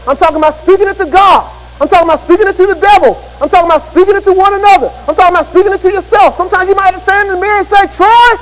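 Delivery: high, driving sermon delivery; full-force preaching. Real, not acted. Preacher urging congregation to speak God's Word, fervent high preaching. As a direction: preach it with building fervour, pitch lifted.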